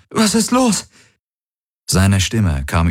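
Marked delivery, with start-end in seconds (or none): 0.1-0.9 much higher, alarmed call of a name; startled cry. A narrator's reading. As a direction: Kirai jolts awake and calls out in fright. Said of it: "rief sie erschrocken".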